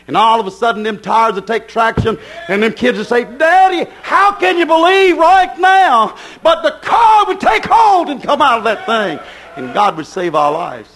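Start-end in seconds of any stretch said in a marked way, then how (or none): 3.2-6.2 put-on high, slow child's plea to daddy; frightened child. Children in the sliding car. 6.4-9.2 preaching shout. Real, not acted. Preacher climaxing the story.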